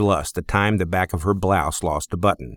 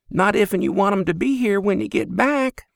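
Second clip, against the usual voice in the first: put-on much higher young woman's voice; Lizzie, girl's voice; 11 semitones higher than usual. Stable girl asks the stranger, much higher young woman's voice. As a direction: ask it straight, a bit wary.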